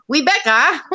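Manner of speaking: imitating a Chinese accent, lightly; a hint of a mock Chinese accent. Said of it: "use my, um, Chinese accent".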